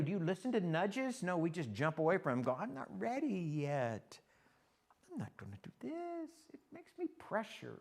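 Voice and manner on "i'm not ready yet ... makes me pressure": high, drawn-out reluctant whine; hammed-up reluctance; mock whining excuse. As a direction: drag out the excuses like someone backing away. Voices reluctant people dodging God's nudges.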